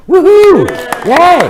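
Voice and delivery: loud, high whoop of joy; real happy cheer after naming her grandkids. Real, not acted. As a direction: cheer like a fan at a game. Cheering after introducing family.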